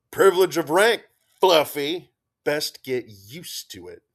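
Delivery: loud teasing quip, then easing off; quipping. Acted. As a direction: tease with a loud grin. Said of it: "Gunny quipped".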